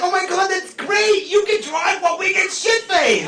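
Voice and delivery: high, delighted mock cheer; hammed-up glee over a designated driver; mock excited friends. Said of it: "They're like".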